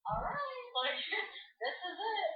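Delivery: high, half-laughing dread; mock dread. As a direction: squeak it out like you're doomed. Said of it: "I was like".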